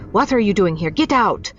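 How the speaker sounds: woman catching a stranger in her room, angry shout. Acted. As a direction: order the intruder out, angry. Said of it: "She looked angry now".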